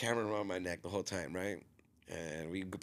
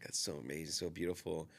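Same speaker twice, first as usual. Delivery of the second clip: soft praise; 4 semitones lower, 6 dB quieter than usual. Retold or imitated. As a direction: lower and softer, a lightly voiced gentle guru. Imitating the guru's soft praise.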